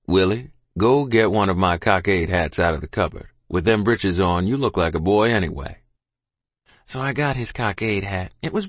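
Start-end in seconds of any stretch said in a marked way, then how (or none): 0.1-5.8 low, firm father's instruction. A narrator's reading. Father sends child for a hat, firm. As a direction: give orders in a lower, steady voice.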